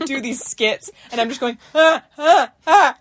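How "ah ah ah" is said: loud, high half-laughing half-crying wails. Retold or imitated. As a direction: wail between laughing and crying. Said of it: "making this laughing and crying".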